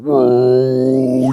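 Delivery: long drawn-out woozy "whoa"; imitated slow-motion drone. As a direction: stretch it out as if the world is slowing. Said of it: "it's fucking slowing down".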